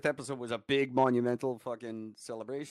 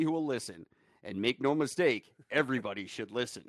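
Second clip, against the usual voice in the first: a hint of an announcer reading ad copy; promo read; 3 semitones higher than usual. Host reading his old podcast promo to listeners, mild announcer voice. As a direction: read it like a grand trailer.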